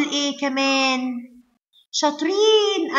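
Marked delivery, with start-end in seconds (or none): 1.9-3.0 high, drawn-out cheer; delighted praise. Real, not acted. Teacher praises KG1 pupils for answering, high and drawn out. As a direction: stretch it out high and proud, praising little ones.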